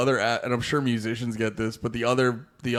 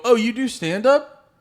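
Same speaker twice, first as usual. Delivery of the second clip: higher, louder mock gush; mock fan surprise; 10 semitones higher, 5 dB louder than usual. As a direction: imitate an eager stranger, pitched up. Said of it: "they go".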